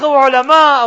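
normal talking voice

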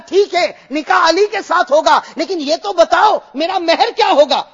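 high, loud fervent sermon; impassioned preaching. Acted. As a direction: build it up and proclaim. Preaching Fatima's question loudly. (بلند آواز میں پرجوش خطابت)

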